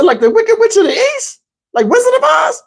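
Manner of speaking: high-pitched naive amazement; comic disbelief, voice climbing high; astonished question. Retold or imitated. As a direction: ask it in a squeaky, awed voice.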